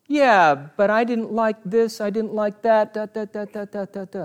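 high, whiny mock grumbling; mock complainer. Preacher mocking a believer who only complains, whiny. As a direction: parody a grumbler who trails off.

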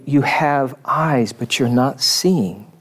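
slow, deliberate words of the Lord; the Lord speaking, slowly. Retold or imitated. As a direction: lay it out slowly, as the Lord's rebuke. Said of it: "if the Lord says to me".

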